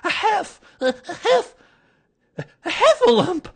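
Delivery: high, loud gasps building to the whole word; winded, panicked little squeal; Piglet out of breath. A narrator's reading. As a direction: stammer it between panting breaths. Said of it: "breathing so hard that he could hardly speak".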